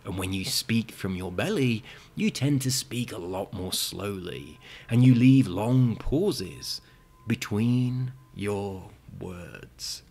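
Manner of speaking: slow hypnotist voice with long pauses; hypnotic voice. Shown as a demonstration. Hypnotist showing viewers his stage induction voice, slow. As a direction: ease them into trance, slowly. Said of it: "kinda like my hypnotic voice".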